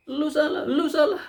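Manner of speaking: high, laughing mock accusation of an angry man; mock angry blaming. Preacher mimics an angry driver blaming others, high and laughing. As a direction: mimic a hothead pointing fingers, half laughing.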